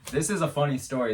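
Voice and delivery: ordinary talk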